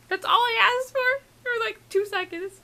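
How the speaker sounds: very high, squeaky complaint; high-pitched fangirl lament; pleading lament. Real, not acted. Lamenting the missed eye contact.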